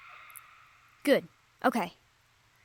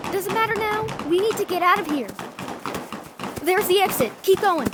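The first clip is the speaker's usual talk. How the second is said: loud, higher, urgent calls while running; urgent escape shouting; 7 semitones higher, 3 dB louder than usual. Acted. Adventurer hurrying partner to the getaway car, loud and urgent. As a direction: push your partner toward the exit.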